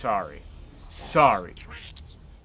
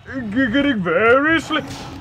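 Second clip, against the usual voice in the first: mock hypnotist; 8 semitones higher, 5 dB louder than usual. Player hypnotising the dinosaur, loud mock hypnotist. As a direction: play a hurried, high-pitched hypnotist. Playing hypnotist, cut off in alarm.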